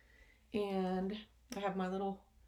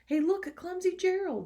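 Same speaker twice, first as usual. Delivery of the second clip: higher, louder mocking jeer; sneering animals; 11 semitones higher, 7 dB louder than usual. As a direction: taunt him like a schoolyard bully. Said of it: "the animals all sneered".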